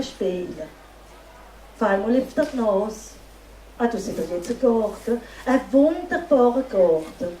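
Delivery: unhurried storyteller's voice with long pauses, painting the moonlit scene; fairy tale narration. A narrator's reading. Storyteller telling a festival audience a fairy tale, dreamy narration. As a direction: draw the audience into the night, line by line.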